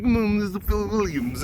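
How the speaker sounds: oddly enunciated journalist impression, pitched high; Phil Williams. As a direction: mimic a reporter's weird enunciation. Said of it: "he would enunciate words kinda weird".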